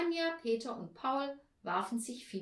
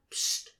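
sharp hush sound; shushing; 4 dB louder than usual. A narrator's reading. Mrs Postel quietens the hide-and-seek game, sharp shush. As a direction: shush the noisy room.

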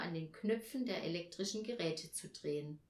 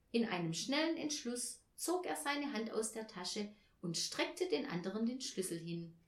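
ordinary delivery, nothing marked

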